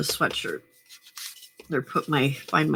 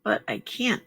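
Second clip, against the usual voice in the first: ordinary delivery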